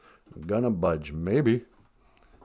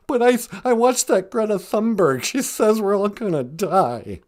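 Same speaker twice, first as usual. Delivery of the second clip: higher-pitched worried lion caller; Cowardly Lion; 11 semitones higher than usual. Acted. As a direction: protest like a scared lion sure of doom. Scared lion caller protests.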